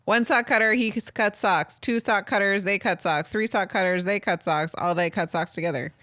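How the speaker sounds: racing through the tongue twister as asked; fast reading. Shown as a demonstration. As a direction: rattle off the tongue twister quickly. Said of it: "Now, say it fast".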